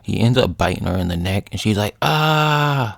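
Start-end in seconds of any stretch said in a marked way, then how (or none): 2.0-3.0 high, drawn-out mock scream at normal volume; mock cry of pain. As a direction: act out being bitten, restrained. Natasha bitten in the neck.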